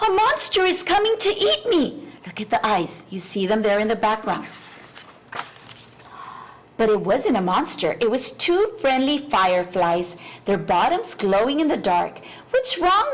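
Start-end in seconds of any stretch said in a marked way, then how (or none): 0.1-2.0 high, panicky squeal of a scared little bee; frightened cry. A narrator's reading. Bee squeals at the glowing eyes, terrified. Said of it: "he cried". 12.5-13.1 high, light firefly voice asking kindly; a bright little firefly checking in; friendly firefly. A narrator's reading. Fireflies ask the lost bee, high and kind.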